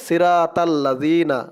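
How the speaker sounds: "সিরাতাল্লাযিনা" with thin ra and light "tal"; common mispronunciation. Shown as a demonstration. Teacher quoting learners' mistake, "সিরাতাল্লাযিনা" with light t. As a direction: say "সিরাতাল্লাযিনা" with flat open a sounds. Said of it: "অনেকে বলে".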